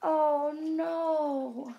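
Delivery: lively, sing-song picture book read; reading to children. A narrator's reading. Teacher reading aloud to kids, lively sing-song. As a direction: draw the little ones into the story.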